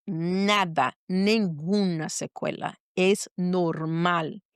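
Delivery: low and very slow, savouring each word; deliberate, drawn-out reassurance; retold solemn verdict. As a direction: deliver the good news with measured weight. Doctor's retold good news.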